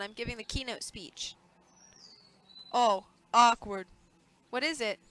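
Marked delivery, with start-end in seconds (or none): 2.7-3.9 slow, loud "awkward"; drawn-out awkward. Acted. Man telling the senator the event is off, slow and awkward. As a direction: stretch it out, deadpan. Realizing the candidate wasn't told.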